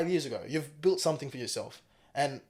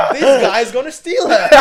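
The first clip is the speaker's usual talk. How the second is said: laughing; 7 semitones higher, 15 dB louder than usual. Real, not acted. Laughing retelling meeting handsome waiter.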